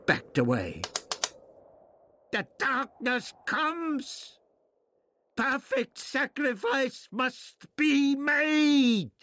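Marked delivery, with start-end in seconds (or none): 2.3-9.1 very high, slow wailing scream at moderate volume. A narrator's reading. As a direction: screech it slowly, stretching the last word. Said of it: "Turtoxel screamed".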